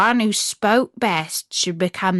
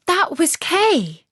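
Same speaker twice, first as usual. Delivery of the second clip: high, slow joyful cry of recognition; girl overjoyed to hear news of her friend; delighted cry; 8 semitones higher, slower than usual. A narrator's reading. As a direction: cry out with delight at finding him. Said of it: "cried Gerda with delight".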